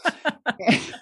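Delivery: high-pitched burst of laughter. Real, not acted. Guest amused by her first book idea, laughing. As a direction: laugh at your own absurd idea.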